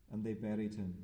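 ordinary talk, nothing marked

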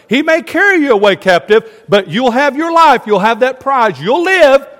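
loud, high, urgent exhortation; full-force preaching. Acted. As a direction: proclaim it as a prophet's warning. Preaches Jeremiah's message at full force.

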